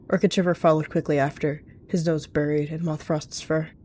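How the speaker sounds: ordinary delivery, nothing marked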